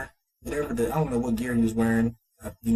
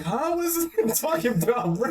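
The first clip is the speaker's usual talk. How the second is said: baffled reaction; 12 semitones higher than usual. Retold or imitated. Own baffled reaction voiced.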